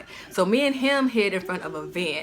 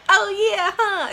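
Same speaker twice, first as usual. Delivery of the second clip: high-pitched, a bit louder, realizing; high sheepish; 13 semitones higher, 5 dB louder, much slower than usual. Retold or imitated. Cousin realizing her mistake, high and sheepish. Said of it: "she was like".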